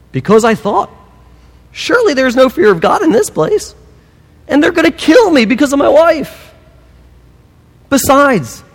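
high-pitched, pleading excuse-maker; mock defensive Abraham. Abraham to the king, explaining himself, high mock pleading.

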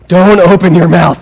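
loud, forceful mock scolding; exasperated warning. Reader to Abraham before he answers, loud exasperated warning. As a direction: bear down hard, as if stopping a blunder.

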